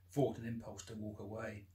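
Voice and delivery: ordinary delivery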